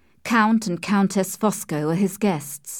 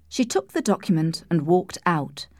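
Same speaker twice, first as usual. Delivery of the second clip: ordinary delivery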